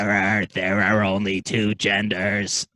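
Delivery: low, slow alien pronouncement; deep, drawn-out alien decree; alien Splurn. Pretend. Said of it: "his name's Splurn".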